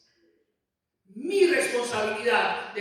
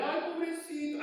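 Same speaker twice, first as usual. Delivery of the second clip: 1 mock pity; 7 semitones higher than usual. Mocking pity plea.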